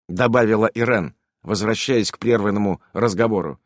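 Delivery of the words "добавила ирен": plain reading voice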